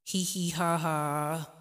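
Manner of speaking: slow, exaggerated fake laughter; mock giggling. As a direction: ham up a forced cheerful giggle. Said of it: "we do not have to be".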